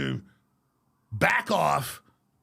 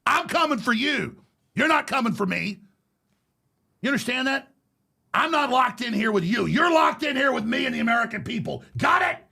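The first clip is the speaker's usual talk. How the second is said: louder, higher war-cry declaration; defiant shout; 6 semitones higher, 3 dB louder than usual. Real, not acted. He warns his persecutors, real defiant shouting. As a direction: hurl it at your enemies.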